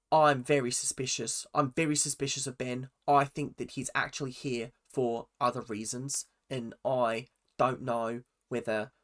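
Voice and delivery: put-on Australian accent; Jordan. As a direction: imitate a suspicious, protective Australian man. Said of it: "let me get into my Australian accent".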